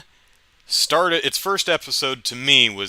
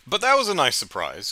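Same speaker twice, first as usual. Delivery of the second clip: usual speaking voice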